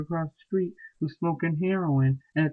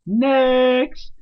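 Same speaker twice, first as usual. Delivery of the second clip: called out dismissal; 7 semitones higher, 5 dB louder than usual. Retold or imitated. Kicks friend to curb, calls out.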